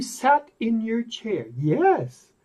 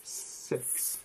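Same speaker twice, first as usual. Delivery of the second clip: soft unvoiced whisper; 18 dB quieter than usual. Shown as a demonstration. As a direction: whisper the word.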